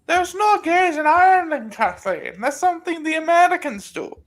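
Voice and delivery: put-on high, loud old Irish man scolding; loud old Irishman. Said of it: "parody ninety-year-old Irishman".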